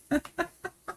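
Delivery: amused little laugh after reading; real laugh. Real, not acted. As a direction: chuckle at the absurd news. Laughs at the scandal headline.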